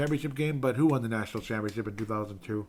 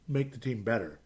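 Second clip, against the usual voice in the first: normal talking voice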